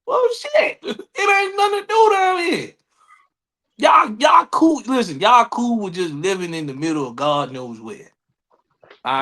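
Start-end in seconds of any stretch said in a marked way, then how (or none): 0.0-2.7 high, laughing put-on voice, much higher; imitating a Southerner who has nothing to do; mock Southerner. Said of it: "He's like". 3.8-4.8 calling out. Retold or imitated. Calling out to Southerners.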